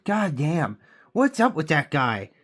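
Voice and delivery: mock the useless onlookers, pitch shooting up; mocking bystanders. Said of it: "everybody will stand around going".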